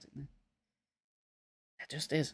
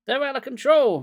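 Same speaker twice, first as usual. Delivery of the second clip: high, loud shriek; panicked kid shrieking; 10 semitones higher, 7 dB louder than usual. A narrator's reading. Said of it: "Marty shrieked".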